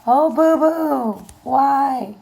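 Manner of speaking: slow, loud, high sympathetic groan; dismayed aww. Real, not acted. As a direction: pity the card out loud. Reacting to the card drawn.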